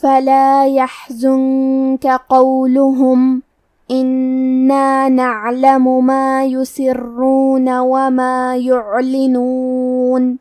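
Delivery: slow, level tajweed chant with long held vowels; sustained, unhurried Quranic chanting; Quran recitation. Shown as a demonstration. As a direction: recite measuredly, drawing out hums and vowels. Recites verses between explanations.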